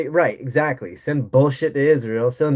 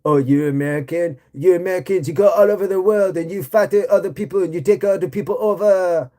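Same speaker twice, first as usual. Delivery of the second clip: a higher, whiny mock of a scolding European; 5 semitones higher than usual. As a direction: sneer at Americans like a smug tourist. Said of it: "So I'm in Europe".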